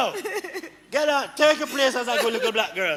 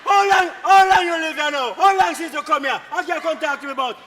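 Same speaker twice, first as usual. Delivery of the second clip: angry berating; 2 semitones higher, 4 dB louder, faster than usual. Acted. Man challenges woman's time in the country, angry berating. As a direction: berate with loud, repeated questions.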